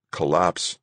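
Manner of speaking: ordinary delivery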